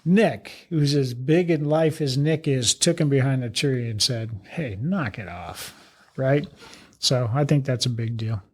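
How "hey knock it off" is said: lower, softer and slow, imitating a chief's private telling-off; quiet reprimand. Chief takes a man aside behind the tree, quiet retold reprimand. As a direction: take the air out of it, a low, slow correction.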